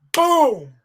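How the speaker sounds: imitating the hit with a boom; mock boom. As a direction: punch the sound out. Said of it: "And he's like".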